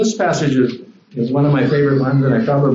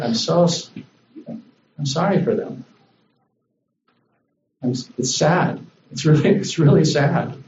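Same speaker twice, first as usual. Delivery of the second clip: grieving; 2 semitones higher than usual. Retold or imitated. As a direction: hesitate over the words as if sorry for them. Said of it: "He was grieved in his heart".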